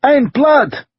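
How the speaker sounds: high loud shout of alarm; man in the mob shouting an accusation. A narrator's reading. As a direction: shout it out, pointing. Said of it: "another man shouted".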